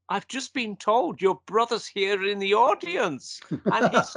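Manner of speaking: slow, high-pitched imitation of a Scot; shocked Scottish accent. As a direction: announce it like an embarrassed Scotsman, drawn out. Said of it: "in his Scottish accent".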